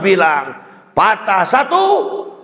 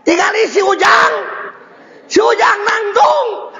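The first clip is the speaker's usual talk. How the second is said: put-on the wife calling her husband over to see the baby stand; excited wife; 12 semitones higher than usual. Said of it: "Pertama kata istrinya".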